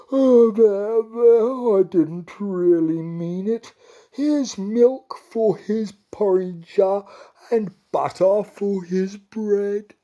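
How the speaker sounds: high, slow apologetic cow; sorry cow. Acted. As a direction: make amends as the cow.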